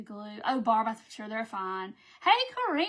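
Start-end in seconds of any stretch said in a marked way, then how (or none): 2.2-2.9 high, loud cheerful hello; bright shout-out to a viewer; excited greeting. Real, not acted. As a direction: call out happily to a friend.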